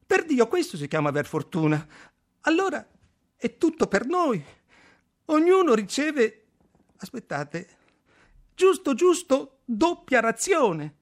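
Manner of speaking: very high, loud, jubilant voice; radiant excitement. A narrator's reading. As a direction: crow over an incredible stroke of luck. (esultante, con voce acutissima)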